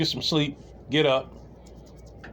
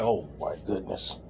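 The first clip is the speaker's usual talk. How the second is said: low, soft, slow gasp of disbelief; stunned hush; 9 semitones lower, 8 dB quieter, slower than usual. Retold or imitated. Walking off after the joke, low hushed disbelief. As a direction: drop way down and breathe it out. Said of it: "I was like".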